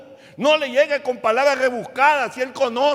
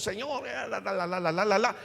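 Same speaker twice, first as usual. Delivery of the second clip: mock empty prayer; 6 dB quieter than usual. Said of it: "Pero no le dice".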